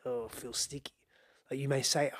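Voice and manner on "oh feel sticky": breathy whispered aside; hushed, almost secret. Real, not acted. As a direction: drop to a whisper on the last words. Feelings that are hard to hold.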